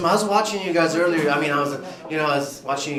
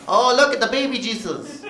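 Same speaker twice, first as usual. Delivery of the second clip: mock mom; 8 semitones higher than usual. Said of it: "She's like".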